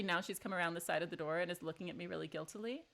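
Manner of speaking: normal talking voice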